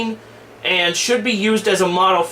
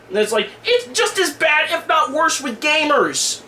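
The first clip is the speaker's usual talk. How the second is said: heated rant; 7 semitones higher than usual. Real, not acted. Heated rant against gamers.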